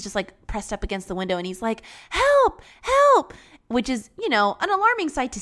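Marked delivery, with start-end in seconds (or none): 2.1-3.3 high, loud retold plea; put-on high yelp for rescue; mock cry for help. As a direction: imitate someone trapped calling out. Trapped neighbor crying at window.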